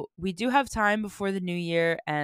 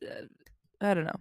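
ordinary talk, nothing marked

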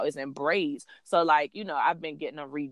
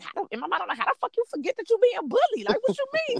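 high-pitched exasperated rush; annoyed mom; faster than usual. Retold or imitated. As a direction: retell it in a squeaky, fed-up burst. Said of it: "I'm even more annoyed".

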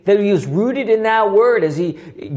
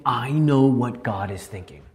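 lightly voiced low devil; devil's insinuation; 9 semitones lower, 4 dB quieter than usual. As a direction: insinuate it in a lowered pitch. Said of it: "as if the devil is saying".

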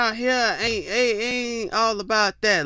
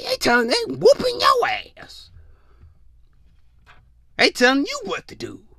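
squeaky whisper; 14 semitones higher than usual. Acted. Exaggerated outrage.

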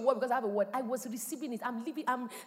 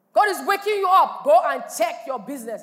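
preaching shout; 3 semitones higher, 10 dB louder than usual. Real, not acted. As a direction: shout it out to wake the church. Preacher rousing the congregation.

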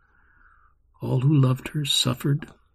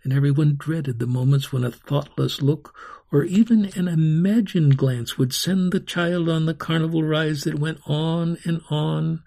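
ordinary delivery

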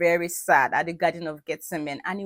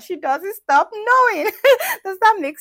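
laughing; 14 semitones higher, 12 dB louder than usual. Real, not acted. Laughing at her own point.

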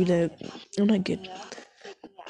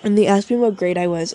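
loud excited; 7 dB louder than usual. Real, not acted. Excited start of a story.

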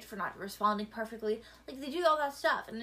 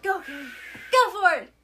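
cheering; 8 semitones higher, 11 dB louder than usual. Real, not acted. Cheering a friend on to whistle.

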